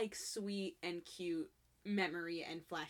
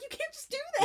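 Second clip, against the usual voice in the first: much higher, shocked protest; squeaky outrage; 4 dB louder, faster than usual. Retold or imitated. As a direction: shriek in disbelief. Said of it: "Hangul's like".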